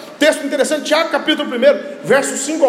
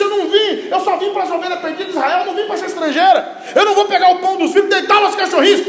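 stern refusal; 10 semitones higher, 3 dB louder than usual. Retold or imitated. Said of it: "Jesus disse".

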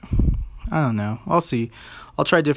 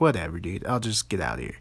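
lower-pitched retold brush-off; mock defeated, dropping in pitch; resigned; 5 semitones lower, 5 dB quieter than usual. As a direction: give up and back out of the argument. Retold giving up after being scolded.

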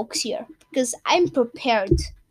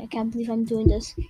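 normal talking voice

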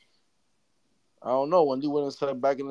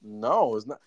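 ordinary talk

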